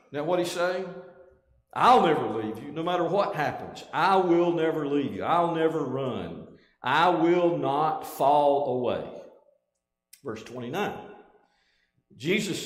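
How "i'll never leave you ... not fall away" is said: insistent vow in punched phrases, slowing on the last; bold and emphatic. Retold or imitated. Peter promising Jesus he'll never run, emphatically. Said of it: "Peter's statement is so emphatic, it's so bold, so straightforward".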